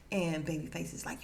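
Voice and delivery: ordinary talk, nothing marked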